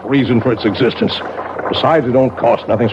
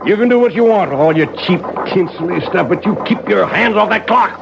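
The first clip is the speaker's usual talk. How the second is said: raised, heated order to back off; angry demand; 8 semitones higher than usual. Acted. Clockmaker ordering Harriet to keep off the clock, heated. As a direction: draw a hard line, furious.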